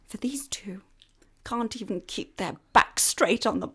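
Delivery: whispering. Acted. Scandalised reveal.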